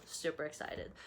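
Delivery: soft whispered repeat; hushed echo of the excitement; whispered aside. Real, not acted. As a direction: whisper it to yourself.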